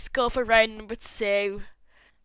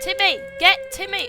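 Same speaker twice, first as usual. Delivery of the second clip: high, loud urgent shouting; frantic yell; 11 semitones higher, 8 dB louder than usual. Real, not acted. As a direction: gamer shouting at the screen. Frantic yelling at game character.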